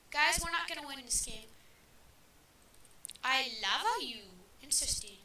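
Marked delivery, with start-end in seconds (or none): none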